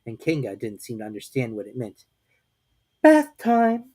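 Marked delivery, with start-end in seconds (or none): 3.0-3.9 higher, louder cheerful mother. A narrator's reading. Kanga to Piglet, cheerful about bath time. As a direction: sing out bath time brightly. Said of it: "in a cheerful voice".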